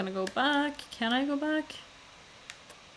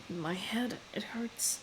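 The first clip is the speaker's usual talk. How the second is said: whispered, softer, in pain; 9 dB quieter, slower than usual. Acted. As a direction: breathe it out through the pain.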